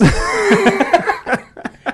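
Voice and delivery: real laugh bursting out high. Real, not acted. Laughing at kid's word.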